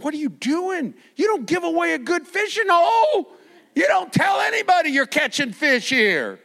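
high, loud stern rebuke with a half laugh; serious scolding. Retold or imitated. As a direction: scold the young man. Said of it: "And he was dead serious".

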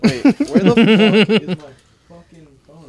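high, laughing, searching; where is it, amused panic; surprised. Real, not acted.

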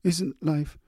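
halting, high and breaking; stammering again. A narrator's reading. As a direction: stumble over it, emotional. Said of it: "she stammered".

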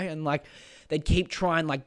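normal talking voice

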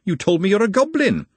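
indignant, high-pitched outburst; upset accusation. Acted. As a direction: throw the accusation at him, pitch soaring. Repeats the accusation, escalating.

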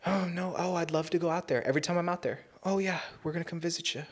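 imitated relatives' excuses. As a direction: put on a high, gushing voice of empty promises. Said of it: "they've been like".

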